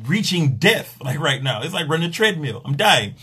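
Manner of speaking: usual speaking voice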